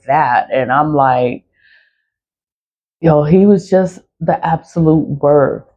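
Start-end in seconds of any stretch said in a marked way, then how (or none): none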